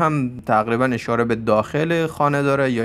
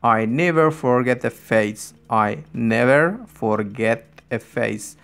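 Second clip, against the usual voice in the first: slow model reading; much slower than usual. Shown as a demonstration. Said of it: "teacher models English sentence".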